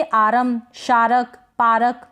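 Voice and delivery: आरम, शारक, पारक, each in two syllables; overdone R with a vowel after it in आरम, शारक, पारक; wrong full R. Shown as a demonstration. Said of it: "तो ये गलत हो जाएगा".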